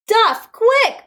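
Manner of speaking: high, loud shout. A narrator's reading. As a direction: call urgently across the park. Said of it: "shouted the scout leader".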